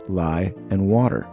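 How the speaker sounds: ordinary talk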